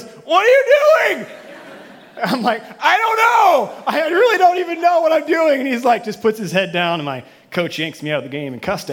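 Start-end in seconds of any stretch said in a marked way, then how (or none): 0.0-1.3 high, loud disbelieving yell with a laugh; incredulous coach. Retold or imitated. Brother-in-law coach shouting at player, high incredulous yell. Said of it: "he goes". 2.8-5.6 flustered shout. Retold or imitated. Player yelling back at coach, high helpless shout.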